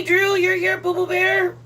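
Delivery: normal talking voice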